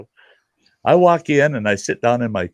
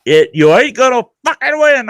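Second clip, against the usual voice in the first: much higher, louder defiant yell; angry yell; 13 semitones higher, 6 dB louder than usual. Retold or imitated. Dad refusing to lose to his son, high defiant yell. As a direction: throw it in his face, mid-fight. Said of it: "and I'm going".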